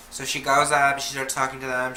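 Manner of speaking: usual speaking voice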